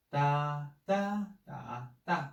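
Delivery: da, da, da, da sung through the four Mandarin tones in turn. Shown as a demonstration. Teacher demonstrating the five Chinese tones on da. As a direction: glide da through level, rising, dipping and falling tones. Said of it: "five tones, like".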